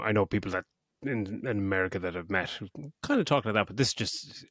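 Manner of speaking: ordinary delivery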